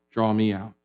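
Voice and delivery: soft exhaled prayer; breath prayer. Shown as a demonstration. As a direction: let it out on the breath.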